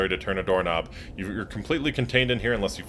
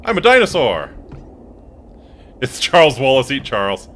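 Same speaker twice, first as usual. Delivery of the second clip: loud dinosaur declaration; 4 semitones higher, 8 dB louder than usual. Acted. Player shouts dinosaur line.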